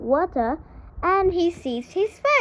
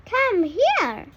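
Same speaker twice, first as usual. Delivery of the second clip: put-on high child voice calling; children calling; 8 semitones higher, 3 dB louder than usual. A narrator's reading. The man's children call the duckling, high and eager. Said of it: "they say".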